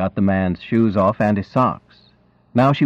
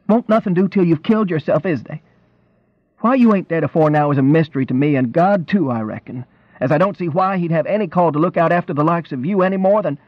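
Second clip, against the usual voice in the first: tearful scolding wife; 8 semitones higher than usual. A narrator's reading. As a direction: scold him, close to crying. Wife scolds reckless husband.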